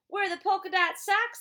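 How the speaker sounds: ordinary delivery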